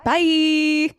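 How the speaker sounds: mock British bye. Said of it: "in my best Gemma accent".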